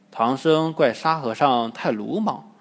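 ordinary delivery, nothing marked

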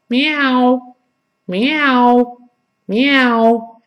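high, drawn-out kitten meow; imitated cat meow. Teacher compares the vowel to a kitten, meowing. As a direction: mew like a little house cat. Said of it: "叫唤时候".

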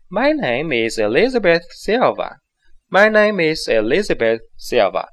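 natural, lively pace; natural speed. Shown as a demonstration. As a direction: say it the way you really would. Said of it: "你做到这样自然的状态". (自然语速连读)

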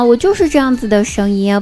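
normal talking voice

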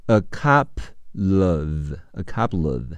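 "a couple of" with the final l linked into "of"; linked pronunciation. Shown as a demonstration. Teacher showing listening form, "couple of" linked as "cup-luv". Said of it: "要连起来读".

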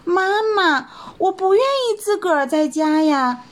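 high bunny voice, lightly tearful; little rabbit crying. Acted. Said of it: "小白兔哭了起来".

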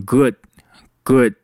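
"good" slowly, its final d unreleased; slow demo of "good" without the final d; dropped D. Shown as a demonstration. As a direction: cut off "good" before the d releases. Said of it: "good里面的D省略掉".

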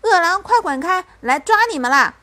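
loud, high chase-away cry; playful threatening yell; menacing shout. A narrator's reading. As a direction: scare them off with gusto. Mother demonstrates scaring wolves.

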